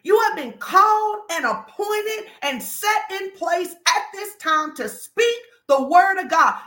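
normal talking voice

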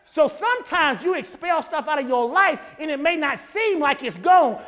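loud, shouted sermon climax; preaching at full force. Acted. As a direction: whoop it up to the congregation.

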